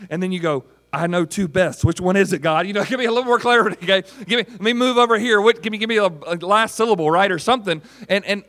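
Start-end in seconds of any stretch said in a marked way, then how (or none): 0.9-7.8 loud, high, hurried haggling with God; mock frantic pleading. Confused believer begging God for more clarity, comically frantic. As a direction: badger for clarity in comic desperation.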